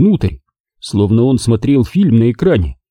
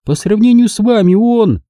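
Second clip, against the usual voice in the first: high, louder taunt, cut off; Margo taunting; 11 semitones higher, 3 dB louder than usual. A narrator's reading. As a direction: needle him and get cut off. Margo continues her jab.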